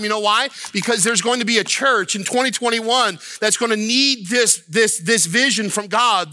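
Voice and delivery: high-pitched, urgent pulpit delivery; impassioned preaching. Real, not acted. Preacher telling the church why Scripture was written, impassioned. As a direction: drive the point home with rising fervor.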